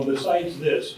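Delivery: ordinary delivery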